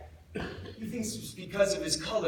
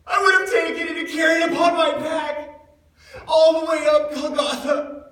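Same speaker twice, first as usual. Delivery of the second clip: raised high and loud, almost crying out; anguished shout; 7 semitones higher, 10 dB louder than usual. Acted. Disciple crying out his wish to carry the cross, anguished shout. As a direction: cry it out with regret that burns. Wishes he had carried the cross.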